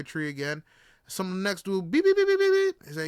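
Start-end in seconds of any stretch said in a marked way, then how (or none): 1.9-2.9 high, loud run of beeps; imitated car horn. Storyteller mimicking the next taxi, high loud beeps. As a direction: honk like a passing taxi.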